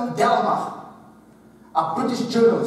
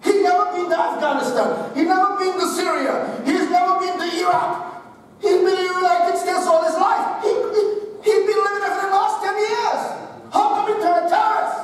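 raised, incredulous questioning with a laugh in it; mock disbelief; 8 semitones higher than usual. People puzzled about the van attacker, mock incredulous and higher. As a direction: play the baffled public, half laughing. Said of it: "And they say".

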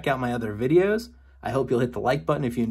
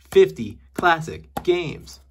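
slower, louder, ending on a rise; dramatic slow repeat; 5 semitones higher, 5 dB louder, much slower than usual. Pretend. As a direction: announce "Fifty classic games" big and slow with a lift on "games". Repeating title more dramatically.

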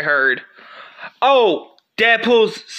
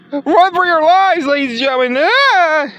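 much higher, louder yell of panic; panicked screaming; 10 semitones higher, 5 dB louder than usual. Acted. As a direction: scream a warning and flee. Panic, robot attacking.

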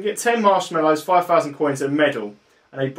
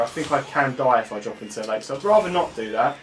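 ordinary delivery

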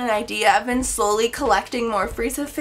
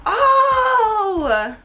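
excited gasp; 9 semitones higher, 4 dB louder than usual. Real, not acted. Thrilled gasp at a gift.

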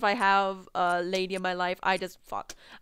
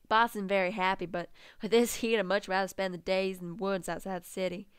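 ordinary talk, nothing marked

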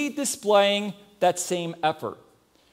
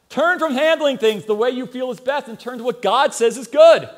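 louder, higher call to turn; fervent preaching; 5 semitones higher, 7 dB louder than usual. Acted. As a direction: preach it out with full conviction. Preaching at full force.

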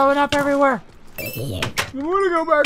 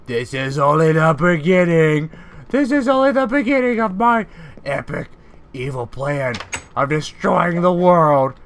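dark lord; 6 semitones lower than usual. Acted. Evil plan declaration.

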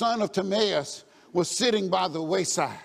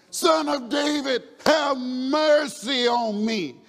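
high, slow, loud plea; crying out; 6 semitones higher, 5 dB louder, much slower than usual. Acted. Blind man defies the crowd to reach Jesus, crying out. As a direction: cry over the crowd, stretching each word. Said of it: "But he cried".

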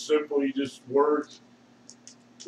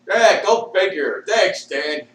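ordinary talk, nothing marked

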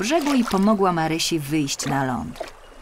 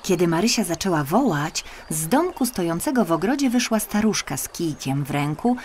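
ordinary delivery, nothing marked

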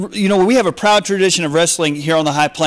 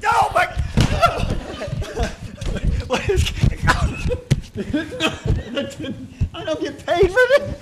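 laughing; 11 semitones higher, 6 dB quieter than usual. Real, not acted. Host being slammed, laughing.

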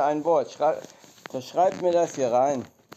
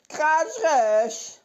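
high, strange voice saying Kratsch rechts backwards; backwards speech; 12 semitones higher, 3 dB louder than usual. Shown as a demonstration. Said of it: "rückwärts".

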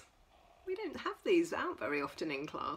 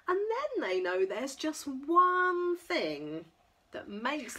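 lively sing-song picture-book reading; reading to children; 5 semitones higher, 4 dB louder, slower than usual. Acted. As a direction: read it bright and playful for toddlers. Reads picture book to toddlers.

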